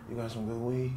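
very soft, low mutter; imitated hushed, indistinct question; imitating mumbled request. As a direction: murmur it like a furtive offer. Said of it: "all I know was I heard".

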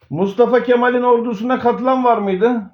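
ordinary talk

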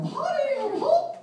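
very high cartoon falsetto greeting; chirpy mouse singsong calling out; Mickey Mouse voice. Mickey calling to Minnie as he walks in, falsetto.

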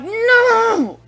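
high, loud cry of no; yelling no. Retold or imitated. Driver shouting at a deer on the road. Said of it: "and I'm like".

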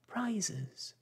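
high excited call. Acted. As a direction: cry it out eagerly. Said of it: "calling out in a confused way".

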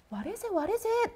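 soft, high questioning; hushed teasing. Real, not acted. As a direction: coax an answer in a small voice. Teases the audience for answer.

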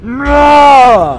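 loud, high shout of "Nah!". Real, not acted. Player leaping off in the game, yelling loud and high. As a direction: let out a big falling yell.

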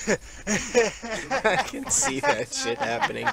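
high-pitched, slow words through laughter; giggling too hard to talk normally; laughing. Real, not acted. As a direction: crack up while talking. Laughing at the joke.

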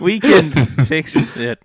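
loud, high, slow mocking imitation; mock unconfident. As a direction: parody a teammate's faltering reassurance. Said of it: "You don't sound too confident".

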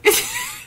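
squeaky laugh through tears; high teary laugh. Real, not acted.

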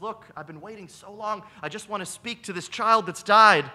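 rising from pleading to a loud angry burst; furious. A narrator's reading. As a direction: she has waited too long and lets it out. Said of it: "woman is furious".

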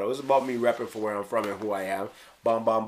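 normal talking voice

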